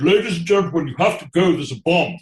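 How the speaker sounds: high, loud actor's proclamation to the audience; loud, grand theatrical announcement of a bomb; booming stage announcement. Retold or imitated. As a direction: boom it out to the whole house like a stage star. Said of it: "and he said".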